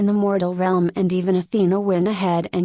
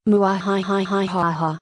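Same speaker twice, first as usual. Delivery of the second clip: a lightly voiced villain cackle, muahahaha; evil laugh. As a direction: roll out a mock evil laugh. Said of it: "Loki begins to laugh out loud".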